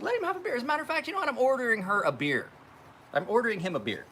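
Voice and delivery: high mocking imitation of wife; high, mocking wife impression. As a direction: parody her bossiness in a high voice. Retelling mocking wife at friend's beer.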